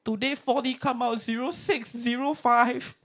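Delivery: very high, outraged auntie; godma voice. Retold or imitated. Godma complaining the lottery number came out, very high auntie squeal. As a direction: cry out the bad luck in a high squeal.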